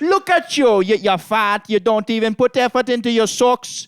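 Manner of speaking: put-on loud, high Jamaican accent roast. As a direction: shout it in a full Jamaican accent. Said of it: "You gotta be loud to do it".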